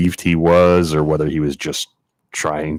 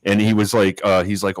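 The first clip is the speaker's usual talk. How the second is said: usual speaking voice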